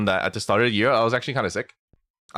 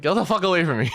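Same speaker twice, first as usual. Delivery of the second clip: higher, raised mock snarl; retold angry yell; 8 semitones higher, faster than usual. Shoving mom off, retold heated shout.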